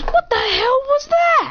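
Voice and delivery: high, drawn-out disbelief; shocked outcry. Acted. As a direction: squeal it slowly in alarm. Startled character in acted story.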